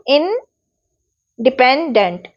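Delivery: Independent pulled apart slowly, इन - Dipen - dent; syllable by syllable. Shown as a demonstration. Teacher demonstrating word stress, independent in slow separate syllables. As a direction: break independent into slow pieces with pauses between. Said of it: "dent पर हम ज़्यादा जोर दे रहे हैं".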